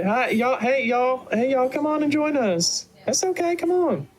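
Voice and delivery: high, folksy mama calling out; high-pitched motherly invitation; welcoming mother. Acted. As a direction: call the shy friends over to join you. Mother calls the party over.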